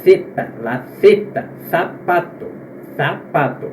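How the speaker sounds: tongue between the teeth for "Zeta" and "sapato"; lisped th starting "Zeta" and "sapato", spaced out; Z of Spain. Shown as a demonstration. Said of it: "na Espanha ele é pronunciado assim".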